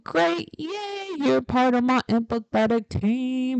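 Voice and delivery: high, delighted "great, yay"; excited cheer. Real, not acted. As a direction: cheer the viewer on. Cheering empathetic viewers.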